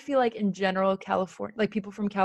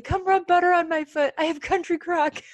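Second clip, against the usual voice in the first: demanding, higher-pitched quote; whiny boss ordering help, pitched up high; Michael from The Office; 10 semitones higher, 4 dB louder than usual. Retold or imitated. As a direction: act out a needy, loud plea. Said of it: "And he tells Pam, he's like".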